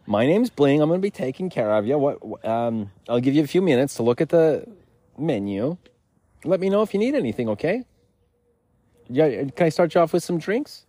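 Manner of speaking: high, sing-song server voice; chirpy waiter greeting the table; perky waiter Bling. Pretend. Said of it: "try and get a job at Applebee's".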